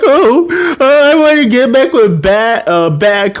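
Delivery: high whiny fake sobbing; hammed-up teary plea; mock crying. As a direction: wail like a heartbroken girl. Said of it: "and she's crying".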